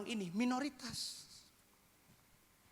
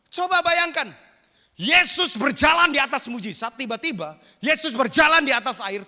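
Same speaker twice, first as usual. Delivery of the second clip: much higher, much louder preaching shout; shouting preacher; 8 semitones higher, 12 dB louder than usual. Real, not acted. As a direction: hit the congregation with full force. Preacher's loud climax. (teriakan khotbah yang keras dan tinggi)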